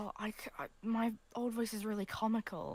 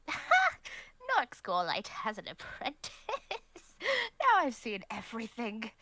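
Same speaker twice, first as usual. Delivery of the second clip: scoffing laugh; 4 semitones higher, 10 dB louder, slower than usual. Acted. Mysterious stranger scoffs, laughing.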